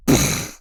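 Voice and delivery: short mouth-made crash; imitated crash noise. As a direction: make the noise of a car smashing through. Imitates car smashing into garage.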